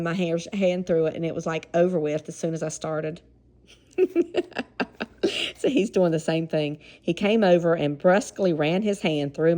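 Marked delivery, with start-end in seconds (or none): 4.0-5.5 high, airy laugh; breathy laugh. Real, not acted. Recalling a friend's haircut, laughing. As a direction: laugh it out in short puffs. Reader laughs at her memory.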